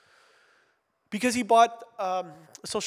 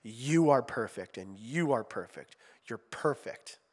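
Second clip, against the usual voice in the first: slow, low-pitched gentle reassurance; compassionate, kind; 6 semitones lower, slower than usual. Retold or imitated. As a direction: imitate a gentle instructor's reassurance. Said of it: "in a very compassionate, kind way".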